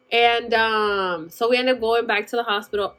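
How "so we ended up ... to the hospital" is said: usual speaking voice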